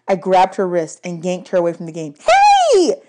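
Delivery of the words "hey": high, loud yelp of protest; angry shriek. A narrator's reading. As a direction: shriek at being grabbed. Annabeth yanked away.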